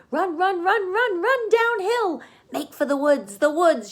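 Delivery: very high, loud honking goose voice; goose shouting. A narrator's reading. The goose shouting to Wilbur to run for the woods. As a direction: shriek it out like a frantic goose. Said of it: "she shouted to Wilbur".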